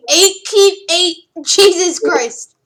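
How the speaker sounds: excited shout. Real, not acted. Dice roll reaction, shouting.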